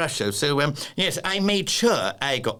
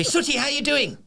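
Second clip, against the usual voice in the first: very high, chirpy phone greeting; bright squeal of a greeting; calling down the phone; 13 semitones higher than usual. Retold or imitated. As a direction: sing out a hello to a friend. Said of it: "when we have a conversation down the phone".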